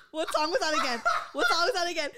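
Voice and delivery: mocking question through laughter; laughing mock question; teasing, laughing. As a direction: tease her with a laugh. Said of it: "and you were like".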